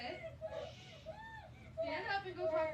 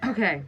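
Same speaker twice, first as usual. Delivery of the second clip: exasperated laugh; 8 semitones lower, 14 dB louder than usual. Real, not acted. Parent laughing helplessly at the tantrum. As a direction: laugh off the despair. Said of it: "it'll all end soon".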